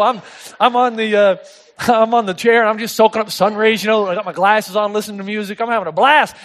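normal talking voice

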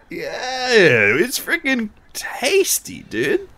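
slow, high stoner drawl. Pretend. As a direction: drawl it like a baked surfer. Joking stoner bit.

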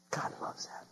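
very soft, voiceless whisper; hushed whisper. Real, not acted. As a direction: whisper it like a treasured truth. Hushed repeat for emphasis.

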